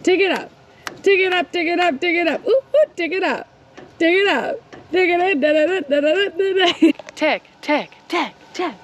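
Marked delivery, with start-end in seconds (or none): none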